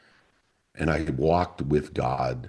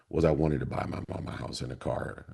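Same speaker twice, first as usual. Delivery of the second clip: normal talking voice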